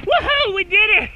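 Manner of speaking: high, loud victory whoop; triumphant cheer. Real, not acted. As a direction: celebrate the finish. Making it down the hill.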